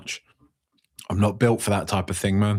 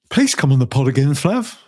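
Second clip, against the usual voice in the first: loud fast plea, [name] pitched up high; calling out to [name]; 3 semitones higher, 5 dB louder than usual. Real, not acted. Host to absent guest, loud plea to come back. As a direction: call it out across the room.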